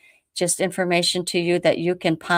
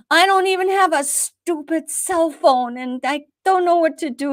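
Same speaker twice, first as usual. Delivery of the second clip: distressed plea; 11 semitones higher than usual. Retold or imitated. Retold distressed reply.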